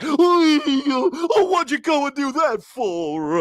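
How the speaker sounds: high, whining wail as the cowardly lion. Retold or imitated. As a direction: cry it out like a scared big cat. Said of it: "the cowardly lion".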